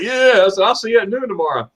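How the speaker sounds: high, gleeful retold yes; delighted acceptance. Customer accepting the special noon opening, retold glee. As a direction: accept eagerly, pitch soaring.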